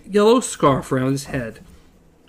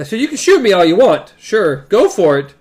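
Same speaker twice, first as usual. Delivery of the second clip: loud taunting; 4 semitones higher, 8 dB louder than usual. Retold or imitated. Voicing the pirate's taunt.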